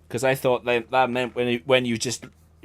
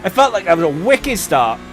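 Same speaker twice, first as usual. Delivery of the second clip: loud, high and pleased; excited; 6 semitones higher, 7 dB louder than usual. Real, not acted. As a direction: cheer the start out loud. Happy with the race start.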